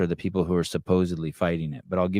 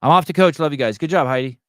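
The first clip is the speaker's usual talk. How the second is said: higher and louder, upbeat farewell; loud sign-off; 7 semitones higher, 6 dB louder than usual. Real, not acted. Heading off to coach, calling bye to listeners, loud and cheery. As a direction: call out your goodbyes on the way out. Signing off, shouting goodbyes.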